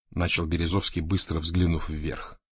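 ordinary delivery, nothing marked

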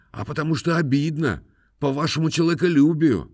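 high, aggrieved peasant falsetto. A narrator's reading. Khromoy's plaintive speech. (высокий обиженный голос мужика)

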